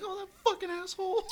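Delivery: high mocking squeaky voice; high put-on voice of someone storming off annoyed; imitating annoyed person. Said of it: "they're like".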